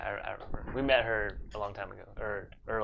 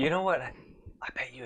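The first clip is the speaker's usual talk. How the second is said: whispering; 3 semitones higher, 4 dB louder, faster than usual. Pretend. Whispering in enemy city.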